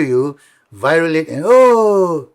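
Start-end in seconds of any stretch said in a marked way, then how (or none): 1.4-2.3 mock surprise. Fan spots celebrity, goes viral.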